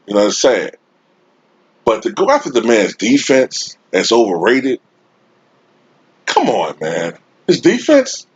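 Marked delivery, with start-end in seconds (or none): 6.2-8.3 incredulous. Real, not acted. Fan answering critics of Jordan's defense, incredulous. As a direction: shoot the pitch up in disbelief.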